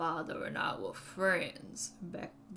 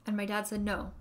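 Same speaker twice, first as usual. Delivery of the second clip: ordinary delivery, nothing marked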